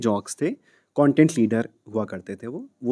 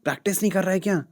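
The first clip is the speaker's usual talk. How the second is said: raised-pitch phone scolding; questioning mentor; 8 semitones higher, 5 dB louder than usual. Retold or imitated. Mentor phoning his trainee about stopped voice notes, reproachful. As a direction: demand an explanation, pitched up.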